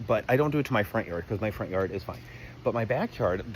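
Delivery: normal talking voice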